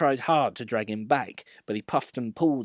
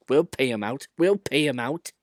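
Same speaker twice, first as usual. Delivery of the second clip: scheming; 3 semitones higher, 4 dB louder than usual. A narrator's reading. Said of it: "grumbled the coaches".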